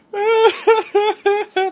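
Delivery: real laughter breaking out, high and loud; helpless high-pitched laugh. Real, not acted. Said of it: "we were laughing so hard".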